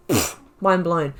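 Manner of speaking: ordinary talk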